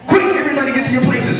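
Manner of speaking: fast, high-pitched rallying cry; urgent alarm. Acted. Mice scrambling as the woman arrives, fast high urgency. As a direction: rush the order out, pitched high.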